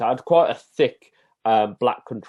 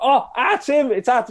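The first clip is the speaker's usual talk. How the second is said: high, loud, delighted "Oh, r tim"; delighted northerner; 14 semitones higher, 6 dB louder than usual. Retold or imitated. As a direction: pretend to be a northern fan who is thrilled. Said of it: "they're like".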